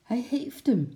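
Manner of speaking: high, excited call. A narrator's reading. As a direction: cry out in surprise to the crowd. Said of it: "riep de slagersjongen".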